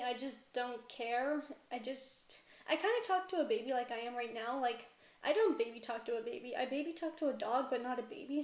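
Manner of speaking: ordinary talk, nothing marked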